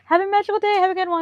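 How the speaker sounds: bright forced customer service cheer; chirpy cast member. Retold or imitated. Cast member sends the speaker off, high sing-song fake cheer. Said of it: "she's like".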